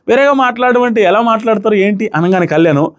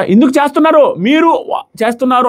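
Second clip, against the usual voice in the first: higher-pitched imitation of an angry retort; mock-heated protest at a raised voice; retold angry outburst; 6 semitones higher than usual. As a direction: replay her flare-up, pitched up. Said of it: "కోపంలో కూడా".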